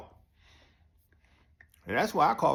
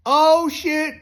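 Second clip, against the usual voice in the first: oh no; 12 semitones higher, 8 dB louder than usual. Retold or imitated. Realizes he's about to be beaten.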